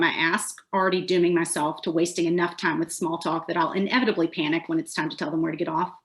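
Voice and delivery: ordinary delivery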